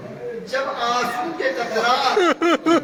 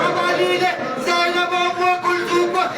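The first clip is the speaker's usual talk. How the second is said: raised, chanted lament told to the mourners; mournful majlis recitation; 4 semitones higher than usual. Acted. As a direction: wail the story out as a grieving chant.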